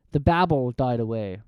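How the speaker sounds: ordinary delivery, nothing marked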